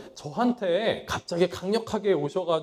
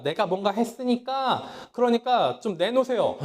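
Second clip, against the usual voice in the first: higher and louder, cheeky insistence; mock demand; 6 semitones higher, 5 dB louder than usual. Preacher mimicking himself demanding reward, hammed-up insistence. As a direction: hold out your hand and demand what you're owed.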